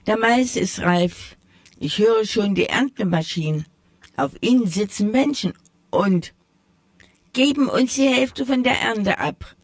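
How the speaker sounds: lightly voiced children calling out hopefully; mouse children calling. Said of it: "riefen die Mäusekinder".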